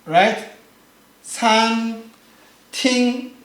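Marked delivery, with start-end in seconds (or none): 1.2-3.4 SAN TING, slow level syllables; sweet first tone. Shown as a demonstration. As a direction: hold san and ting flat on one pitch. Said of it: "is very sweet".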